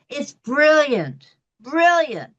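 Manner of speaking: high, slow gushing praise; raving. Real, not acted. Critic raving to viewers about the actresses, high and slow. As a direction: lean into brilliant with delight. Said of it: "critic raving about show".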